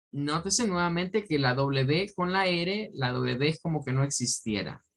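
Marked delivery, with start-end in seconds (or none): none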